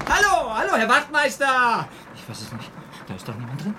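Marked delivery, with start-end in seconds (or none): 0.1-1.9 much higher, loud, slow yelling call; desperate shout. Acted. Young man desperately shouts for the sergeant, loud high yell. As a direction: hammer the words at the locked door.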